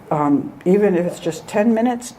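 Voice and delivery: ordinary talk, nothing marked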